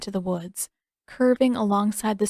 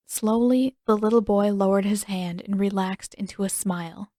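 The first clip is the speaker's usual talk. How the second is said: usual speaking voice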